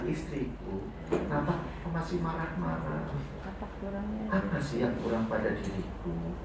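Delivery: soft, tender question to the wife; gentle asking. Pretend. Husband softly asking his wife why she is angry. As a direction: ask her softly what you lack. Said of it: "Malah tanyanya sebaliknya".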